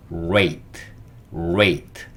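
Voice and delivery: "rate" with the tongue curled inward for a rounded R; R pronunciation demo. Shown as a demonstration. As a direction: curl the tongue back to start "rate". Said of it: "혀를 안으로 말아줘요". (혀를 안으로 만 R 발음 시범)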